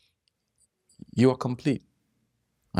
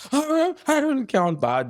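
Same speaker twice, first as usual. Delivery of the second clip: mock fuss; slower than usual. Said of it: "You don't have to, like".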